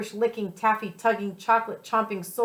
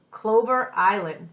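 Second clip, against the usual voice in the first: ordinary delivery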